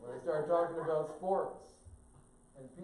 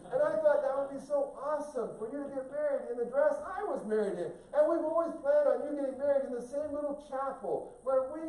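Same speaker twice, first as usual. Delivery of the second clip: gushing mother; 10 semitones higher, 7 dB louder than usual. Retold or imitated. Mother gushing over wedding plans.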